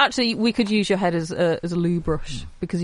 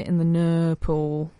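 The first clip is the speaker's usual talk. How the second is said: real Northern vowel, "no" as "ner"; slow Northern "no pool", close to "nurple"; Northern accent; 4 semitones lower, slower than usual. Real, not acted. Employee slipping her set line into a mock meeting, Northern accent. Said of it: "They did it 'cause I'm Northern".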